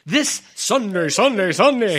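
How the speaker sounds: announcer voice. Acted. Hyping the show announcer style.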